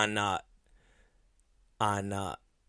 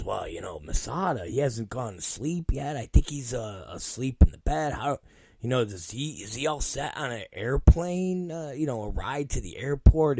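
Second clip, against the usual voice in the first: nice caring voice, hushed; Michael Moran; 2 semitones lower, 7 dB quieter than usual. Retold or imitated. Producer worrying over a colleague's ride, soft caring voice. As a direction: imitate a softly fretting, considerate friend. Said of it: "caring voice".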